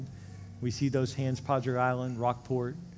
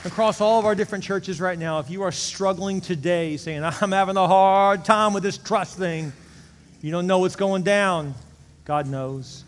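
loud, rising preacher's call to stand; preaching at full force; 6 semitones higher, 8 dB louder than usual. Acted. Pastor urging every campus to their feet, loud preaching. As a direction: push it out to the whole room, building.

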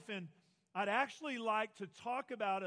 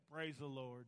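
lower, softer resigned mock praise; resigned sigh; 6 semitones lower, 4 dB quieter than usual. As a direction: say it like a dad giving up. Dad resigned to makeup mess.

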